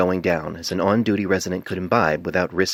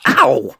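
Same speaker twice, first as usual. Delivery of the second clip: high, loud yelp of pain; sharp yelp; 6 semitones higher, 8 dB louder than usual. A narrator's reading. Painless hit by a thrown clipboard, sharp yelp. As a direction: yelp as if struck on the head. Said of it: "he yelped".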